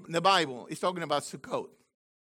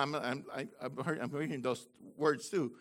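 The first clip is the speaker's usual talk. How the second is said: usual speaking voice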